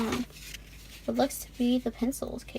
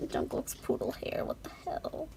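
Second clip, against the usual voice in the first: hushed aside to herself; soft whispered shock; 4 semitones higher, 7 dB quieter than usual. Real, not acted. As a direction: whisper it in disbelief. Whispering over photos.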